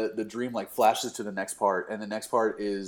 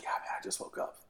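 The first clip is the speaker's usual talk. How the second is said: whispered, groggy phone excuse; fake sleepy voice; 14 dB quieter than usual. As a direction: imitate a friend feigning sleep. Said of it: "you were just like".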